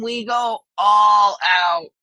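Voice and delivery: slow, loud, ALL punched hard; emphatic all out. Real, not acted. Host tells chat about Thanksgiving, loud slow emphasis. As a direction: shout "we go all out" slowly, hitting "all". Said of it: "And I mean".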